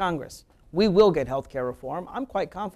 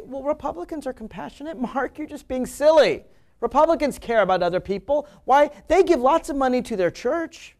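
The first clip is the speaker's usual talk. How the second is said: mock critic; 7 semitones higher, 4 dB louder than usual. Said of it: "there are some that say".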